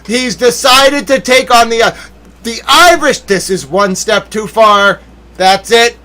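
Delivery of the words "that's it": a rising "That's it" with a touch of heat; mock anger. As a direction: warn that you've had enough, half joking. Mock anger building again.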